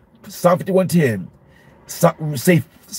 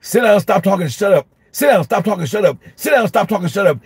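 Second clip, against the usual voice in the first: higher-pitched, rattled-off string of identical orders; repeated teacher mantra; 4 semitones higher than usual. Retold or imitated. Teacher with only three phrases for every class, chanted orders. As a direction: reel off the same commands like a chant. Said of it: "That was my mantra".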